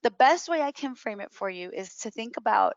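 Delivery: ordinary talk, nothing marked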